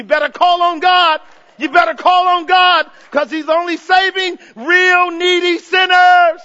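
loud, high, insistent exhortation; preaching shout. Real, not acted. Preacher urging sinners to call on God, shouting. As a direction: drive it home at full force.

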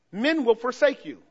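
usual speaking voice

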